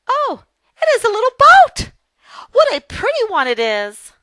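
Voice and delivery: very high, loud burst of excitement; delighted exclamation. Acted. Lucy sees the little boat, exclaiming in high, loud delight. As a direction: exclaim with big delight, easing down in pitch.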